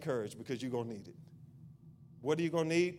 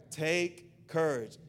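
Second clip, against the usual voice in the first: ordinary delivery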